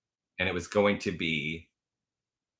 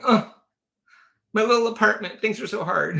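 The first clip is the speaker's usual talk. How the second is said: higher, hammed-up self-pitying whine; mock boo-hooing; 13 semitones higher, 4 dB louder than usual. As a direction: whine about the little apartment in jest. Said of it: "boo-hooing".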